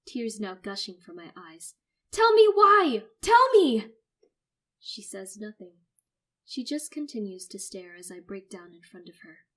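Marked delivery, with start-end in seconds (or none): 2.1-3.9 desperate crying. Acted. Son breaking down.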